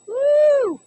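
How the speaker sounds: high, loud delighted woo; excited whoop. Real, not acted. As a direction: whoop as the bread splashes. Messy cooking excitement.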